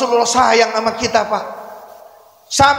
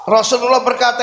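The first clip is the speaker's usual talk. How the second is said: usual speaking voice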